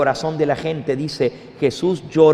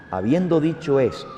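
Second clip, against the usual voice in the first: ordinary talk, nothing marked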